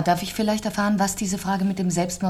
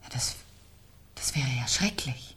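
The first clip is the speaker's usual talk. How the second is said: faltering, hushed whisper; horrified whisper; 7 semitones lower, 10 dB quieter than usual. Acted. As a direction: let the horror steal her voice. Widow horrified by the murder. (stockendes, entsetztes Flüstern)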